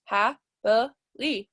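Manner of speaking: slow, broken into separate syllables. Shown as a demonstration. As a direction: say HA-BA-LY one syllable at a time. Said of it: "count how many times my chin goes down when I say it".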